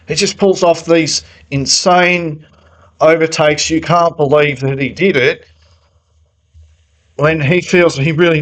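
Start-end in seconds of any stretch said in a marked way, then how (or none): none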